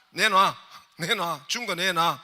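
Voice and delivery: loud, slow mock demand to give it back; hammed-up loud demand to hand it over. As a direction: bark it out like snatching the gift back. Preacher acts snatching back.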